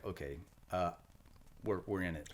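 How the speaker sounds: plain acceptance, no panic; matter-of-fact. Retold or imitated. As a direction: state it as simple fact, unruffled. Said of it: "just like a matter of fact".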